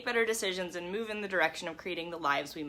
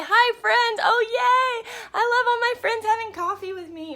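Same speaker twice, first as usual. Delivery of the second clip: excited greeting; 12 semitones higher, 7 dB louder than usual. Real, not acted. Greeting viewers joining the stream.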